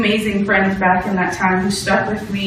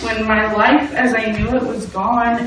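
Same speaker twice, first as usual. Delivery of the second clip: normal talking voice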